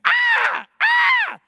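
two high, loud screams; mimicked double shriek at full volume; imitated scream. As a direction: shriek twice, sudden and piercing. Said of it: "Then I scream too".

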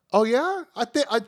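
high-pitched, pleased-with-himself imitation; mock smugness. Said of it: "with this smug look on his".

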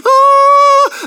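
long, high, loud held "ahh"; imitated angel choir. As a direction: sing out one high note like angels, hammed-up. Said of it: "the angels were going".

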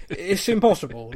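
ordinary delivery

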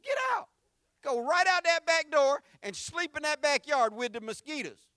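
high, loud command to get out; dad booming his son out the back door; ordering him out. Retold or imitated. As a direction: throw him out with your voice.

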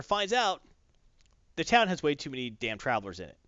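ordinary delivery

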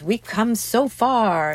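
slow, drawn-out mock platitude. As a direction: say it like a tired cliché, slowly.

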